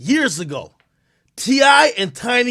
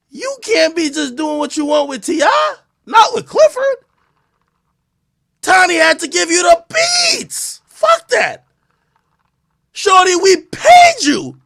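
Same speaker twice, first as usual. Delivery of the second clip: higher, louder shouted scolding; mock angry rant; 9 semitones higher, 5 dB louder than usual. Playing judge, he shouts down the accuser in mock anger. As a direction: scold her like a furious judge.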